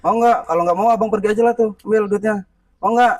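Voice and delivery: high, insistent offer; impatient pressing. Real, not acted. Man pushing money on the preman, impatient.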